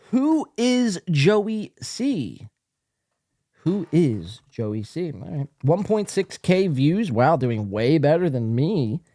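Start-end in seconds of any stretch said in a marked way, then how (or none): 0.1-2.5 high, very slow, each word set apart; drawn-out title reading. Acted. Host reads the show's title out to viewers, drawn out. 3.6-5.1 slow, lower repeat of the title; low pondering repeat. Acted. Host repeats the odd title to himself, low and slow.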